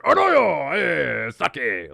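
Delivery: Arroyo rolled out high and loud in a hammed-up Spanish accent; Spanish inflection. Shown as a demonstration. Streamer mocking his own name-calling, hammed-up Spanish accent. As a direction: give the name a mock Spanish accent, over the top. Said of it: "put a little Spanish inflection on here".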